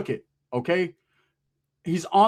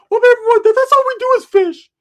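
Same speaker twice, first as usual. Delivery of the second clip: much higher, loud squeaky cousin voice; Rick's squeaky voice; 10 dB louder than usual. Retold or imitated. Cousin Rick answering, high loud squeaky voice. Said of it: "I said".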